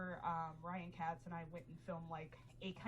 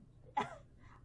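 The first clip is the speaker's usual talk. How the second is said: high laugh. Real, not acted. Laugh after retort.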